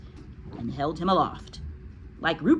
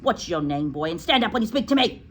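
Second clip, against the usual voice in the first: higher, sharp scolding of a pupil; outraged headmistress; 6 semitones higher than usual. Acted. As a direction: scold the boy as an indignant headmistress. Headmistress scolds boy in story.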